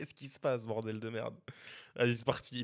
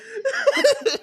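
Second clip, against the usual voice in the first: high burst of laughter; 5 dB louder than usual. Real, not acted. Embarrassed at own intro.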